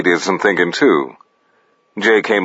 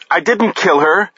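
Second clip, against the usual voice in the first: insistent denial; 11 semitones higher than usual. A narrator's reading. Denies killing to grieving man.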